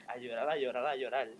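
normal talking voice

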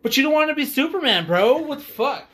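high, loud, fast outburst; outraged outburst. Real, not acted. Venting at the actor, loud outburst. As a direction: explode at him.

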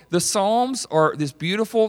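ordinary delivery

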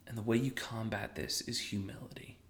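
hushed reverent whisper. Retold or imitated. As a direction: whisper it like a secret. Quoting author, whispered.

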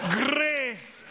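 high, proclaimed Scottish "Grace"; Scottish or Irish accent. Acted. Preacher proclaiming the word to the church, high Scottish-accented cry. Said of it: "can't say it except for in Scottish or Irish".

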